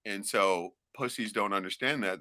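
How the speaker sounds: usual speaking voice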